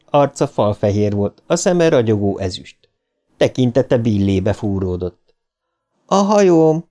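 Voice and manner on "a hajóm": high wail of a lost soul; high-pitched cry from the doomed dead; damned ghost voice. A narrator's reading. Dead George calls from the tunnel for his boat, damned wail. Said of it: "George elkárhozott hangja egyre erősebb lett".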